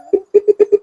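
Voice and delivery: high, squeaky burst of laughter; short helpless giggling fit; laughing. Real, not acted. Laughs at own glue joke.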